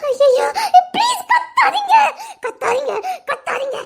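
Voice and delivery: panicked cry fading into weaker begging; frantic pleading. Acted. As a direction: start high and desperate, then sink. Frantic begging not to shout.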